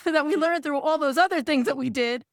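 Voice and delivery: normal talking voice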